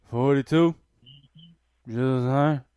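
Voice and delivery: loud barked answer; barked military recruit reply. Retold or imitated. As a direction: snap it out like a recruit. Retold private's answer, loud.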